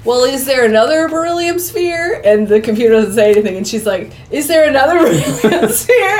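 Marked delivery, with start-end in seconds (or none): 0.1-2.2 slightly hammed-up group question, higher; mock-earnest question, lifted pitch; crew asking. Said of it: "They're all like". 4.3-6.2 higher, laughing mock repeat; hammed-up relay to the computer; relaying the question. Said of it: "she's repeating what the computer says".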